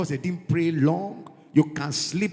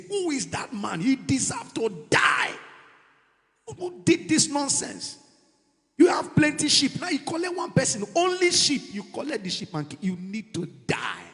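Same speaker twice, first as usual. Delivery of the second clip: louder, higher indignant shouting; outraged shout; 7 semitones higher, 3 dB louder than usual. Retold or imitated. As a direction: rage at the injustice. David voiced outraged.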